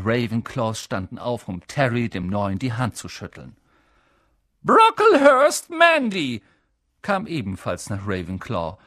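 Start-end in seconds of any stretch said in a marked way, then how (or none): 4.6-6.4 high, loud call across the hall; calling out name. A narrator's reading. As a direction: announce the pupil to the whole room. Calls out names. (Professorin ruft laut den Namen auf)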